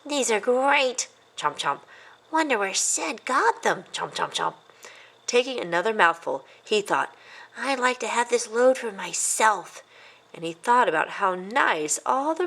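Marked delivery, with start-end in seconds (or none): 0.0-4.5 put-on higher, boyish voice, delighted and munching; a little mouse chomping happily through stolen crumbs; excited young mouse with his mouth full; young mouse exclaiming. A narrator's reading. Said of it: "he exclaimed to himself". 7.5-9.8 put-on lightly higher boyish voice, greedy thought; a hint of a scheming little mouse; mildly voiced young mouse musing; young mouse thinking. A narrator's reading.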